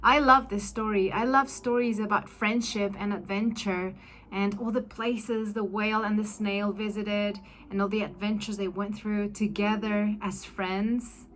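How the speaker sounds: plain reading voice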